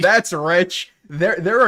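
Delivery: scoffing laugh, loud. Real, not acted. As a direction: laugh it off in disbelief. Laughs at the clip's claim.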